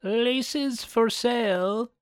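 put-on high, slow old crone calling wares; old peddler woman. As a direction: call out like a witchy old hag selling laces. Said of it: "dressed up like an old peddler ... called out".

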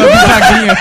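laughing. Real, not acted. Joking about dad's bread cutting.

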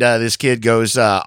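ordinary delivery

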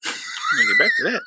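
high, laughing through the words. Real, not acted. Laughing off a botched saying with his friend. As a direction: laugh off the slip.

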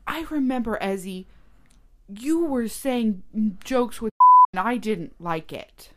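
loud, high retold scolding; mock indignation. Girl telling off boy over jokes, indignant. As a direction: imitate a cross little girl, hitting words hard. Imitates girl mad at him.

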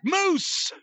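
high, shouted name call; hammed-up announcer shout; mock promoter yell. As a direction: bellow the wrestler's name to the crowd. Promoter voice calling out wrestler.

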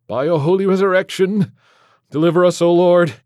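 high, loud fervent prayer; defiant liturgical cry; shouted litany. Acted. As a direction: pray over the demon at full voice. Priest drowns out the demon.

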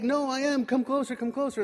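high, loud, eager coaxing; urgent insistence. Retold or imitated. Joseph urges disbelieving brothers closer.

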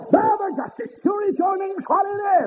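high ringing preacher's cry; preaching at full force. Acted. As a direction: lift it up and ring it out to the congregation.

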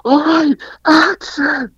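high, loud call of action; retold scream. Director screaming action at the cast, loud call. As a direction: yell it across the set. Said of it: "we hear Michael scream".

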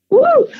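high, loud whoop of joy; a burst of overwhelmed cheer; excited woo. Real, not acted. Singer on the phone whoops with real excitement.

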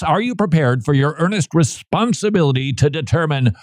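ordinary delivery

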